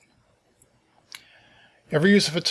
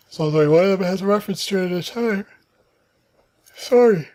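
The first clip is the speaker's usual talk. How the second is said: talking through a yawn, higher and louder; 8 semitones higher, 7 dB louder than usual. Real, not acted. Tired presenter reading to viewers, yawning mid-sentence. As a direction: let a yawn stretch the words. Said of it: "Sorry for the yawning".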